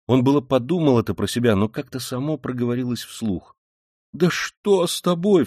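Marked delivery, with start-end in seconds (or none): 4.1-5.4 high, slow cry of alarm. A narrator's reading. Mother to her strange-acting son, alarmed cry. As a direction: cry out, stretching the words, pitch raised. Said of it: "вскрикнула мать".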